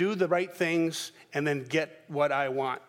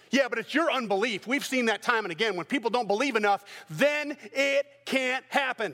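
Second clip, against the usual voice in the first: higher, defensive excuse; defensive disciples; 7 semitones higher than usual. Acted. Disciples defending themselves to the accusers, higher. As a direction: blame their unbelief. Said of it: "the disciples are going".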